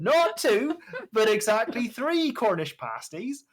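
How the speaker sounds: put-on high, slow West Country accent. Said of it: "West Country accent".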